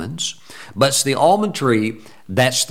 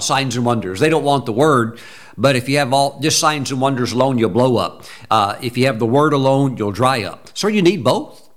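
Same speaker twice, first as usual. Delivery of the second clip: usual speaking voice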